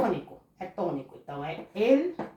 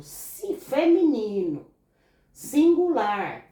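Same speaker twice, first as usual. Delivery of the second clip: slow, louder, drawn-out key words; stressed key words; 3 semitones higher, 5 dB louder, slower than usual. Shown as a demonstration. Teacher stressing the rule for the class, slow and emphatic. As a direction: lean on each rule word. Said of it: "Diante de qualquer substantivo".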